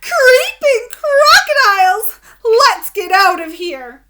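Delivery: high, loud panicked yell; frightened yell. A narrator's reading. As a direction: scream and run from the crocodile. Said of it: "They yelled".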